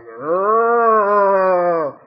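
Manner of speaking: long, loud cultist moan; zombie-like groan. Retold or imitated. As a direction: drone it out like a mindless ghoul. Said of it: "They're all like".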